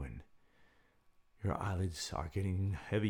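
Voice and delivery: slow, soft calming guide; guided meditation. Acted. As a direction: ease them into relaxation. Leads a breathing exercise.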